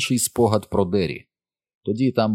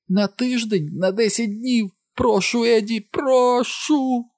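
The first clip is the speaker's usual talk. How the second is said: very high, melodramatic wail; histrionic, high-pitched pleading; bad-opera prima donna; slower than usual. A narrator's reading. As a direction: wail and beg like a hammy diva, stretching the last word. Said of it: "наче примадонна в якійсь поганенькій опері".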